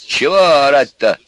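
loud, high rebuke; exasperated loud question. A narrator's reading. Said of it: "тоже повысил голос отец".

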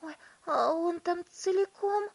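high-pitched anxious question; worried mother. A narrator's reading. Mother checking her son is whole, worried. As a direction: ask in a fretful high voice. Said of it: "забеспокоилась миссис Тиви".

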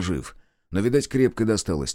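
ordinary delivery

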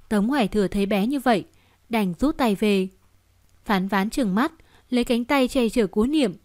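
usual speaking voice

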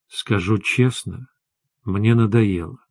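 low, soft, slowed-down confiding; mysterious hushed voice. A narrator's reading. Olga leans across the table to confide, mysterious and hushed. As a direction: lean in quietly, as if sharing a secret. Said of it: "сказала таинственным шёпотом".

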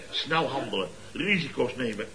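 usual speaking voice

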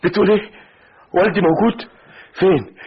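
high, urgent burst of questions; stunned disbelief. Acted. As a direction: react in shock, pitch leaping up. Stunned by news his father is found. (ذهول ولهفة بصوت عالي)

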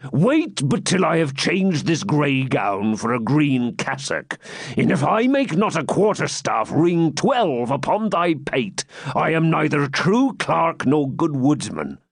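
high, slow, threatening bluster; blustering friar. Acted. Friar challenging the knight to quarterstaffs, blustering. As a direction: threaten a beating as a boastful friar.

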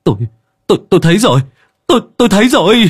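excited shout of discovery. Acted. Villager finds the drowned boy.

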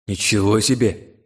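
drawn-out amazement; slow, understated wonder; surprised. A narrator's reading. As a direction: marvel slowly at the result. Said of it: "удивился я полученному эффекту".

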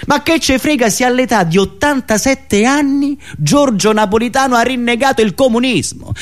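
usual speaking voice

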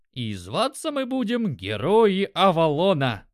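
grand, loud mock-heroic proclamation; pompous voice. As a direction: announce it like an epic hero. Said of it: "сымитировал пафосный голос".

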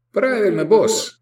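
high, jolly cheer; exclaiming. A narrator's reading. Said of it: "воскликнул он".

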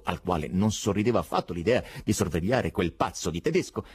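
ordinary delivery, nothing marked

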